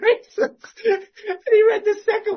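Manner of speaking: high laughter through the words; laughing at the memory. Real, not acted. Laughs retelling.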